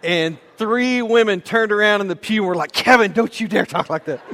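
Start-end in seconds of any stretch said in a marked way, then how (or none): 2.7-4.2 louder, indignant telling-off; mock scolding. Three women in the pew turning on Kevin, mock scolding. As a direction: imitate shocked women scolding him, pitched up a bit.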